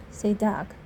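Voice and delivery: usual speaking voice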